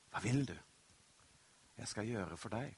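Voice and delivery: soft, low, intimate asking; quiet, unhurried invitation to answer; gentle question. Acted. Jesus' question turned gently to the listeners.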